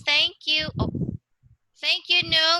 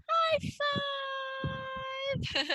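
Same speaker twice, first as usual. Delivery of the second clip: high-pitched, drawn-out cheer; excited high five; 12 semitones higher, 7 dB quieter than usual. Real, not acted. Teacher giving student a high five, excited. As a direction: celebrate with a soft, squealed, stretched-out call.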